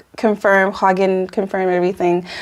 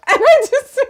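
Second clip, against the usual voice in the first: very high, barely holding in laughter; overcome with laughter. Real, not acted. Telling friends' elevator story, overcome with real laughter. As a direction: squeeze the words out before cracking up.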